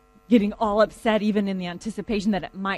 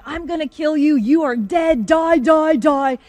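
louder, higher retold yell of fury; imitated furious yelling, raised in pitch; mock yelling; 6 semitones higher, 7 dB louder, slower than usual. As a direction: act out a man yelling death threats. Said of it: "heard the man yelling".

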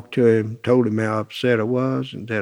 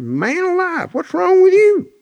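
surprised exclaim. Retold or imitated. Walking in on an angry friend, surprised exclaim. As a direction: jolt him out of his mood.

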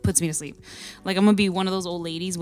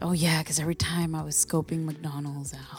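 whispering; 4 semitones lower, 5 dB quieter than usual. Real, not acted. Confiding about McDonald's habit.

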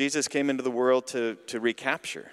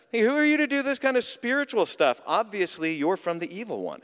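indignant accuser; 7 semitones higher than usual. Retold or imitated. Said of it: "the mad people get even more mad".

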